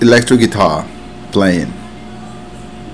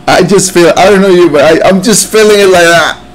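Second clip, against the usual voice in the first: words tumbling out, moved by the song; real emotional outpouring while listening; 6 semitones higher, faster than usual. Real, not acted. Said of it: "I'm too emotional".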